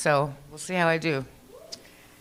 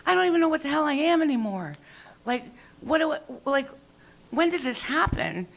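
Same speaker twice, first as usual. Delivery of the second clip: much higher, wailing confusion; mock distress; 9 semitones higher than usual. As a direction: wail it in mock despair. Distressed quote to friend.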